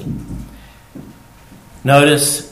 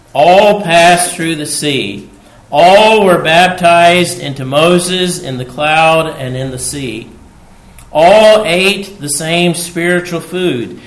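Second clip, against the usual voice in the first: booming scripture reading; 5 semitones higher, 9 dB louder than usual. Acted. Preacher reading Corinthians to the congregation, booming. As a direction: proclaim each "All" as a drumbeat.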